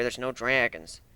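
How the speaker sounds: ordinary delivery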